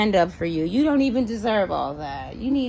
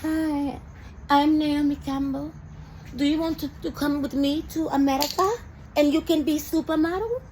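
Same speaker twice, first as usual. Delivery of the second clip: lightly imitating Naomi Campbell's British accent, a bit higher; a teasing, higher take on a model's posh British accent; mock Naomi Campbell accent; 6 semitones higher, 4 dB quieter than usual. Mocking Naomi Campbell, recruiting girls to America, in a lightly put-on British accent. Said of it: "I don't know how you be doing your accent now".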